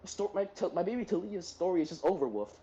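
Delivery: ordinary talk, nothing marked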